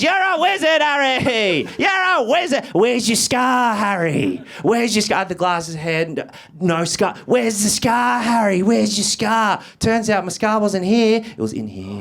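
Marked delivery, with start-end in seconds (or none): 0.0-9.6 taunting schoolkids. Retold or imitated. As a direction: jeer at him relentlessly. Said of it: "It was relentless".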